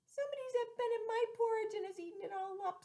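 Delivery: put-on high squeaky baby bear; little wee bear voice. Baby bear finding her empty bowl, high wee voice. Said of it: "in her little, small, wee voice".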